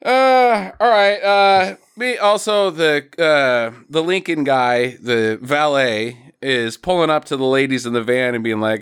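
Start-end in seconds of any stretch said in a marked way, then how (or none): none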